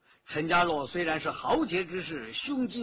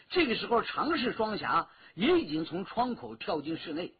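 ordinary delivery

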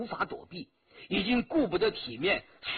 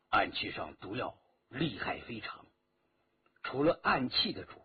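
ordinary delivery

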